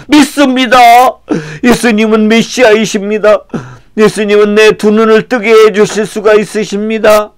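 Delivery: high, impassioned declaration; fervent pleading. Acted. Blind man answering Jesus, fervently declaring belief. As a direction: profess it urgently.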